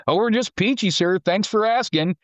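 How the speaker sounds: high, sarcastic fake cheer; sarcastic reply. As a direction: drip sarcasm at the colonel. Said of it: "and he's like".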